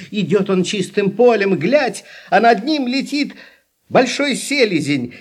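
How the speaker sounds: ordinary delivery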